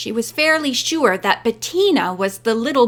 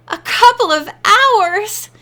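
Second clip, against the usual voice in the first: high, loud dismayed echo; wailing; 9 semitones higher, 5 dB louder than usual. A narrator's reading. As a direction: wail it as a horrified question. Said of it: "Diana wailed".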